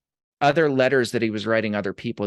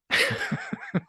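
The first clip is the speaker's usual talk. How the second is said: short laugh; 5 dB quieter than usual. Real, not acted. Laugh after teasing line.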